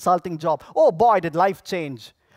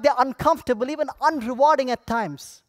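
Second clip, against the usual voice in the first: ordinary talk, nothing marked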